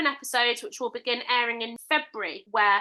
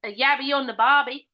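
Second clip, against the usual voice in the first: louder, hammed-up Australian voice; Australian accent; 5 dB louder than usual. Answering what else Australians say, broad Australian accent. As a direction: say it like a cartoon Aussie. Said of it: "what people from Australia say".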